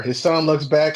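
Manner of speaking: ordinary talk, nothing marked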